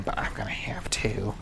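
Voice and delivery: whispered aside. Acted. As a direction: whisper it like a secret. Confiding aside.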